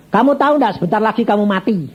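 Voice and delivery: high-pitched, confrontational demand; fierce blunt warning. Retold or imitated. Preacher warning a sick rich banker of death, fierce. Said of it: "saya galak".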